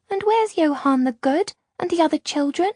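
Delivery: put-on a scared small girl telling how the sea chased her; frightened little girl. A narrator's reading. Lost girl telling Luca about the wave, high and frightened.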